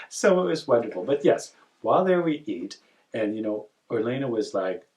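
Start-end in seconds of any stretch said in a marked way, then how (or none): none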